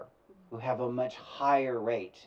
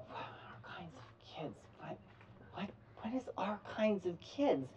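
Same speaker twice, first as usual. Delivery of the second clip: whispered wondering; puzzled murmur; 7 semitones higher, 10 dB quieter than usual. Retold or imitated. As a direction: mull it over half aloud. Puzzled inner question.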